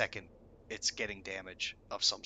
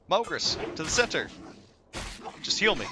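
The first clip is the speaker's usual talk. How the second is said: loud, high shouted orders; mock raid command; 8 semitones higher, 5 dB louder than usual. Raid leader ordering the healer, loud mock command. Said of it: "he's like".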